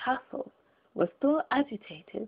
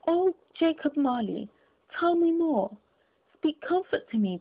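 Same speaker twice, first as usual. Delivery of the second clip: higher, begging plea to the ghost; a mild, earnest plea for comfort; imploring; 7 semitones higher than usual. Acted. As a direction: beg him, a lightly voiced frightened old man. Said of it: "he said imploringly".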